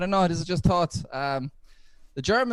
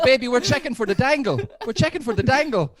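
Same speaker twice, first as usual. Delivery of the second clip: bold mock reply; 7 semitones higher, 4 dB louder than usual. His reply to girlfriend in story.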